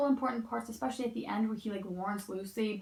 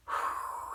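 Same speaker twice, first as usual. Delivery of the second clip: long exhale of exasperation; irritated sigh. Real, not acted. Reviewer venting about the book, irritated sigh. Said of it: "that just irritated me".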